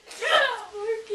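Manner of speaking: ordinary talk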